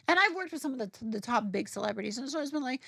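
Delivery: normal talking voice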